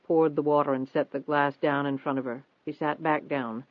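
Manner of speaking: usual speaking voice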